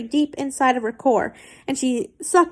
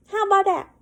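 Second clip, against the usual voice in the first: pitched well up, mocking the line; mock high retake; 8 semitones higher, much faster than usual. Shown as a demonstration. Reader mocking the fanfic line, pitched high. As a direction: squeak it back sarcastically.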